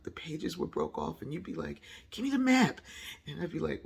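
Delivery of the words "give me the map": high, loud imitated order; mock demand. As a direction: demand it loudly, pitched up. Acts out demand for the map.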